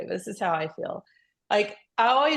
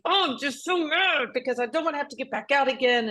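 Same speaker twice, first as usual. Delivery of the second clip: frustrated whine; 5 semitones higher than usual. Retold or imitated. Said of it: "I'm like".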